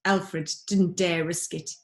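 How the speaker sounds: usual speaking voice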